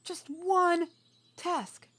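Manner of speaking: loud, high and angry, hitting ONE and TASK; angry scolding. Acted. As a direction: chew her out for botching one simple job. Angry sister scolding for failed task.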